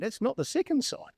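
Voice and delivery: ordinary talk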